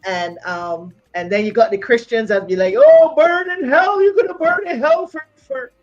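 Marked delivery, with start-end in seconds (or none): none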